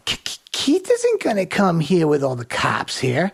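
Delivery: high put-on stutter sliding down. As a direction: stammer like a helpless innocent, faked. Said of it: "I threw out the old".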